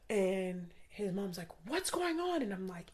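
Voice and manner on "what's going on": alarmed question. Retold or imitated. Alarmed mother bursts in.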